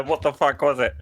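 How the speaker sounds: normal talking voice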